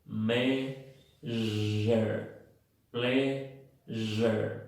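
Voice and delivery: "Measure, pleasure" split in two with a long buzzing zh; slow syllable-by-syllable "Measure, pleasure", buzzing on the s; voiced zh. Shown as a demonstration. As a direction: hold the vibrating "zh" in "Measure, pleasure". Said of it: "En la treinta y seis hay vibración".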